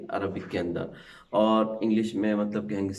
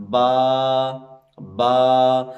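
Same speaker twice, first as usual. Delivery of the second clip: با sung out long and louder, slow; teacher singing the letter با for children; drawn-out loud letter; 8 dB louder, much slower than usual. Shown as a demonstration. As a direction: sing out با with the mouth wide open. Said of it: "آواز پوری باہر نکالیں".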